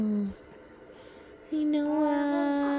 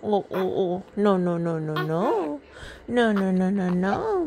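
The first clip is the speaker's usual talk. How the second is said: low hushing; 7 semitones lower than usual. Real, not acted. Mother hushing crying baby.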